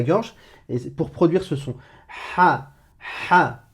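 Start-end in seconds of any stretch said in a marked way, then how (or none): 2.4-3.6 "Ha" breathed out through a tight, raspy throat; Arabic ḥa sound. Shown as a demonstration. Teacher demonstrating "ha" as a scraped breath. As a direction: strangle the breath on "ha". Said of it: "tu vas me le prononcer ha".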